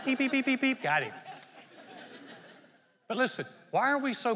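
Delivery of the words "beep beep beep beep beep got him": high playful beeping with a laugh, then a pounce; mock radar beeping. Preacher mimicking God's radar catching a cusser, playful beeps. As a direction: play the radar catching the sinner. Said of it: "his radar is off till you walk in the door".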